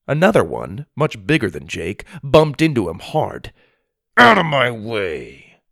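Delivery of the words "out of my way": slow, loud, rude order; bullying big kid. Acted. Big kid shoves Jake aside, rude and loud. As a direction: throw your weight around as a big bully.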